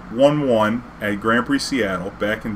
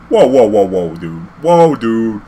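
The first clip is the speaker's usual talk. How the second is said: louder heated whoa; loud protest; 7 dB louder, slower than usual. Retold or imitated. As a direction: push back hard at the judge. Confronting the judge.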